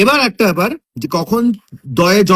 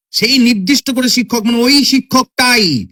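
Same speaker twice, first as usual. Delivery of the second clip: "ওই শিক্ষকটাই" pitched high and drawn out; emphatic pointing-out; 5 semitones higher than usual. Shown as a demonstration. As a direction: stress "শিক্ষকটাই" as that very teacher. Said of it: "জোর দিয়ে বলা".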